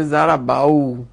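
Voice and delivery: "ضربو" with an a before the final u, ending zaraba-u; awkward fatha pronunciation. Shown as a demonstration. Teacher shows students why fatha before vav is hard, awkward pronunciation. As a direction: drag out "ضربو" as zaraba-u, a clumsy glide.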